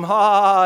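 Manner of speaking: loud mocking devil cackle; evil laugh. Preacher voicing the devil, loud gloating cackle. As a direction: laugh like the devil who thinks he won.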